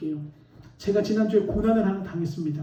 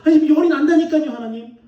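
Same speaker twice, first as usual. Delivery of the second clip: higher, louder insistent retort; arguing back; 6 semitones higher, 7 dB louder than usual. Retold or imitated. Pastor insisting to God that he has a fever, loud acted protest. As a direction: protest it more insistently, pitched up. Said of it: "제가 막 속으로 따졌어요".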